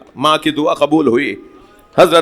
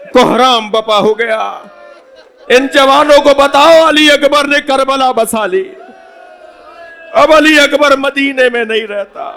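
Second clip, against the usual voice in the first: wailing cry; 8 semitones higher than usual. Acted. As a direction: let it break out as a cry. The final climax of the mourning recital.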